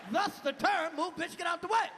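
usual speaking voice

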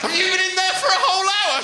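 high, fast incredulous question; horrified disbelief. Acted. Teacher questioning pupil found in cupboard, horrified.

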